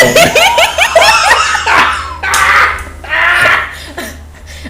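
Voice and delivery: high shrieking burst of laughter; real, uncontrolled high laugh. Real, not acted. Cracking up at the fanfic line, shrieking laugh.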